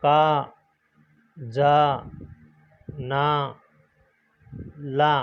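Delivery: का, जा, ना, ला, each with a long आ; reciting syllables. Shown as a demonstration. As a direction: read each आ syllable alone, with pauses. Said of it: "जैसे मान लो पढ़ेंगे".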